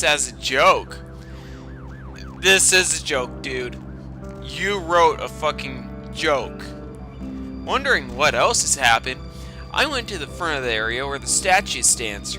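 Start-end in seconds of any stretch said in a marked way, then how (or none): none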